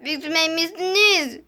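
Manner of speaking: ordinary delivery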